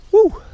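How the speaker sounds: short, high-pitched whoop; excited woo. Real, not acted. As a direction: let out a quick high "woo". Relieved angler releases big fish.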